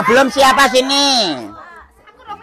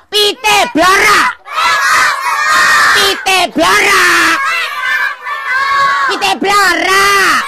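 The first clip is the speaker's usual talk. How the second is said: louder call; 11 semitones higher, slower than usual. Acted. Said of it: "Kurang keras".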